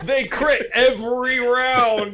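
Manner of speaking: high, slow, laughing exclamation. Real, not acted. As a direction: crack up while stretching out the words. Joking through laughter.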